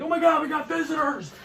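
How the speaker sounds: high-pitched thrilled dog voice; high, giddy cartoon dog; excited dog. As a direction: announce guests like an overjoyed dog. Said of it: "He's so excited".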